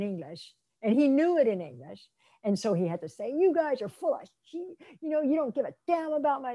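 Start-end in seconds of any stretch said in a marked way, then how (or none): 3.3-6.5 higher-pitched mock outrage; retold angry outburst. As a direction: voice a mildly heated complaint. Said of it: "how angry he was".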